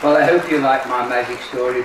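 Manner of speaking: plain reading voice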